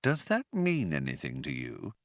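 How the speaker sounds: soft, low question; quietly. A narrator's reading. Trillian quietly asking Zaphod, low and soft. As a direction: ask it quietly, probing. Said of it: "Trillian said quietly".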